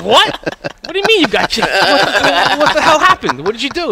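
put-on high, loud shocked kid voice. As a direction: play the son firing disbelieving questions. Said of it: "He's like".

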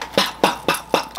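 breathy unvoiced pop pop sounds for punches; mock punch sounds; imitated punches. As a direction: whisper the hits like punches landing. Retelling throwing punches.

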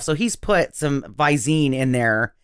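usual speaking voice

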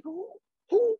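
high-pitched mock indignation; odegrane oburzenie. Acting out reaction to calls.

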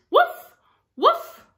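high, loud little puppy woofs; dog barks. Acted. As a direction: bark it like an excited puppy. Dog barks in picture book.

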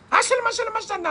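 sudden high-pitched gibberish; resumed fake tongues. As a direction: burst back into showy mock tongues after peeking. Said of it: "and I go so".

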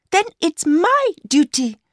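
put-on high-pitched young woman's voice; Mercy, young mistress. A narrator's reading. Mistress telling her lover about the new shoes, high young woman's voice.

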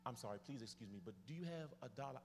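soft, low, hurried plea; apologetic begging. Retold or imitated. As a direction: re-enact a quick embarrassed excuse to strangers. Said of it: "had to stand at the subway and say".

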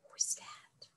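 hushed spooky whisper. Acted. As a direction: whisper as if something is in the room. Reader's hushed aside.

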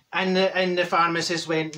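ordinary talk

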